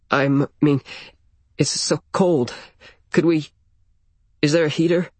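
shivering. A narrator's reading. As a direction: shiver through the words. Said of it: "so I'm shivering".

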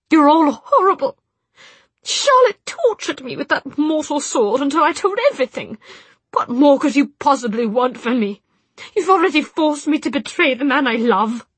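high, loud tragic wail; theatrical despair. Acted. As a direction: ham up the wronged woman.

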